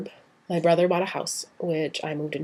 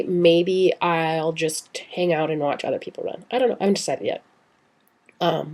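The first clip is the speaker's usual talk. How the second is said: ordinary talk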